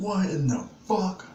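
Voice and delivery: high, slow, stunned "what in the fuck"; stunned disbelief. Retold or imitated. Son hearing police are called, stunned and disbelieving.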